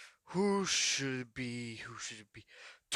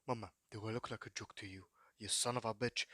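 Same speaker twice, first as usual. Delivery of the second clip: menacing quiet threat; 6 semitones lower, 10 dB quieter than usual. Acted. All For One menacing the dolls, low and soft. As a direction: drop to a low, much softer menace.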